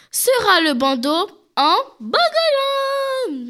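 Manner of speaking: last word sung out high and held long; showy product reveal. Real, not acted. Presenter unveils the first Christmas accessory, showy drawn-out reveal. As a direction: draw out the name like a game-show reveal.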